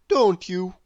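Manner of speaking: plain reading voice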